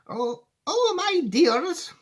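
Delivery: put-on high old woman's wail; alarmed cry. A narrator's reading. Grandma fearing the crash, high wail. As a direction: lament it in high-pitched dismay. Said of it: "cried Grandma Georgina".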